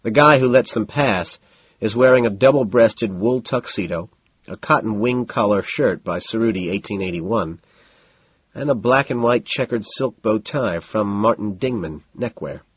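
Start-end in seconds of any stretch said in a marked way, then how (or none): none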